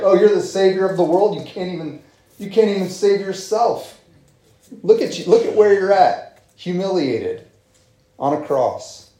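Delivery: high, sneering mockery of the crowd; mocking taunt. Crowd jeering at Jesus on the cross, high mocking taunt. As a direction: taunt him like a jeering bystander. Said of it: "the derision and the mocking, the mocking tones".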